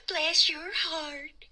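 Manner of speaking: soft, slow Southern drawl, lightly done; a hint of Southern charm; Southern accent. Fish puppet showing off her Southern, in a light Southern accent. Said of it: "teaching me Southern".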